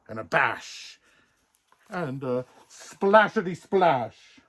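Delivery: plain reading voice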